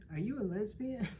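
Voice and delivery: high, hesitant question; curious coworker voice. Retold or imitated. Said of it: "she was like".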